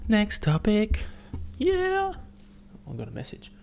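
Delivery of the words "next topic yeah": high, chirpy next topic; moving on from his rant, chirpy sing-song; sing-song segue. Real, not acted. Cheery segue.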